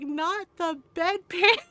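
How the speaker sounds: high, loud, drawn-out amused shock; mock-scandalized. Niece reacting to aunt's bedpan insult, mock-scandalized. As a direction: exclaim slowly as if scandalized.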